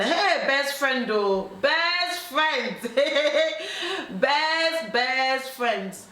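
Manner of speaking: high, drawn-out teasing outcry; laughing, hammed-up dismay; mocking exclamation. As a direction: stretch the words out in mock scandal. Mocking the betraying best friend.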